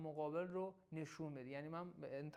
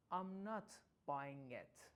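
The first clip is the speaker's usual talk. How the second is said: sceptical and cool; 5 semitones lower, 3 dB quieter, much slower than usual. Shown as a demonstration. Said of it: "خیلی شکاکانه و خونسرد".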